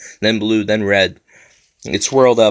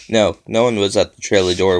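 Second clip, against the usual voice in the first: usual speaking voice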